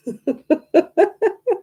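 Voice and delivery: high-pitched giggling laugh; high giggle. Retold or imitated. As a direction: squeal with laughter. Friend laughing.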